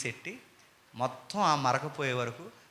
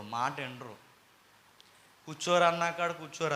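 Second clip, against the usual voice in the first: normal talking voice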